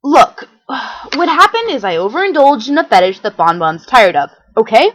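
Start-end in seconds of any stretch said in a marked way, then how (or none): none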